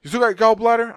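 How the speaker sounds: loud, high mock doctor's question; insistent doctor. ER doctor quizzing his patient, insistent.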